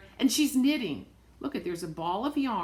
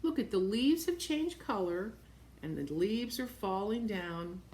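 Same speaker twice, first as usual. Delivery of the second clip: ordinary delivery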